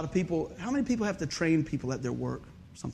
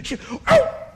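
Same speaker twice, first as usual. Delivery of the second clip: sudden high yelp as he falls; startled cry; 7 dB louder than usual. Retold or imitated. Retelling the moment he falls on rollerblades, high startled yelp. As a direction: yelp as your feet go out from under you.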